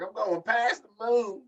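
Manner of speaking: high, drawn-out mock proclamation; mimicked wrestler boast. Quoting the wrestler to co-hosts, hammed-up slow boast. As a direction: stretch it out high, imitating a boaster.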